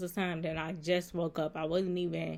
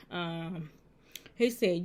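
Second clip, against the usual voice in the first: usual speaking voice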